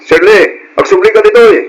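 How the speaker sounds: angry brother yelling after his sister; angry shout. Acted. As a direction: shout her name, loud and high, calling her back. Brother calls drunk sister back. (napigsa nga ikkis a makapungtot)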